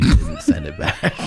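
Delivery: real laughter breaking the quote; laughing. Real, not acted. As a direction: laugh takes over the line. Laughing while quoting her.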